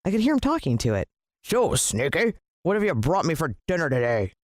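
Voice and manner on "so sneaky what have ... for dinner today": bellowing villain. A narrator's reading. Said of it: "he bellowed".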